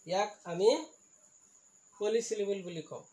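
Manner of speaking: ordinary talk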